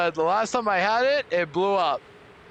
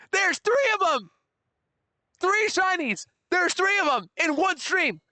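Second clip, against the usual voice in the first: high excited disbelief; high shocked exclaim; excited shout; 11 semitones higher than usual. Real, not acted. Shocked at three shinies.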